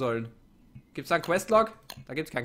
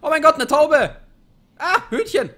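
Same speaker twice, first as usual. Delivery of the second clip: much higher and louder, thrilled; excited shout; 12 semitones higher, 10 dB louder than usual. Real, not acted. Hungry player spotting a bird, thrilled shout. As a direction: yell like you've found dinner.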